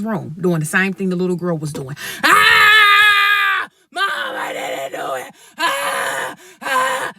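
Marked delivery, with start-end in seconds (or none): 2.2-7.1 put-on high crying scream of a boy. Boy screaming alone upstairs, crying and yelling. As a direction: wail like a kid losing it.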